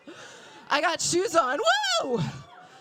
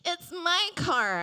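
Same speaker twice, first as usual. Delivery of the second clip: whiny sister; 9 semitones higher, 3 dB louder than usual. Retold or imitated. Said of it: "she was like".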